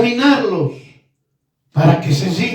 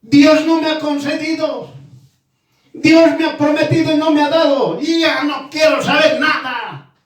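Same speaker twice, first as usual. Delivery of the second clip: bitter complainer; 6 semitones higher than usual. Retold or imitated.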